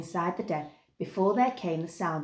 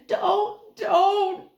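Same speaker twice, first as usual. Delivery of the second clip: feeble moan; 13 semitones higher, 7 dB louder than usual. A narrator's reading. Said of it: "the Snaggart moaned feebly".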